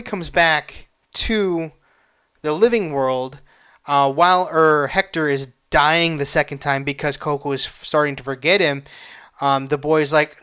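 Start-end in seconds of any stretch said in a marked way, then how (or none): none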